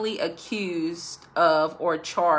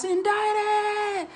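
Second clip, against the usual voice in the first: frantic high shriek of Indicted; hysterical Indicted. Retold or imitated. Dick running from his house, shrieking high in panic. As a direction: yell it like a man in total panic. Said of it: "I would say it the way he said it".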